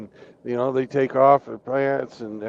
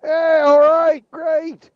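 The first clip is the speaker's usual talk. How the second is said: far higher, loud, slow cheer; whooping Canadians; 7 dB louder, slower than usual. Acted. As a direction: cheer like your team just won. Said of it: "they're going".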